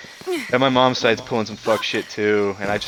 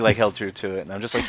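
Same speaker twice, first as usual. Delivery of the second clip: ordinary delivery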